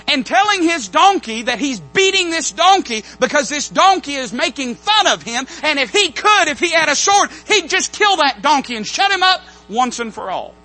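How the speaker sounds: preaching at full force. Acted. Preacher telling how Balaam wants to kill his donkey, loud. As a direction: drive the outrage home.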